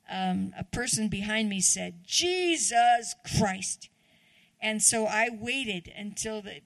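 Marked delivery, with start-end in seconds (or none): 2.1-3.9 slow, louder drawn-out exasperated curse; retold curse. Stranger on the stairs cursing behind her, slow and loud. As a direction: spit out the name in slow exasperation.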